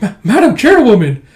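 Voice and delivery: high, loud startled stammer; flustered shock. Retold or imitated. Startled underling greeting Madam Chairwoman, flustered. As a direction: act caught out by the boss.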